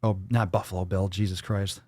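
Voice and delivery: ordinary talk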